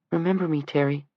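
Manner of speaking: put-on high pleading teenage boy; begging adolescent voice. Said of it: "the adolescent voice begged".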